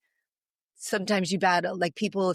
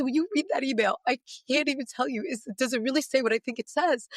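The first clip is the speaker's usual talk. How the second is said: excited disbelief; 5 semitones higher than usual. Retold or imitated.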